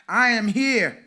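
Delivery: loud, high call for the crowd to echo. Acted. Pastor calling out the affirmation for the congregation to repeat, loud. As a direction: proclaim it to the room. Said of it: "I'm gonna say, "I am here".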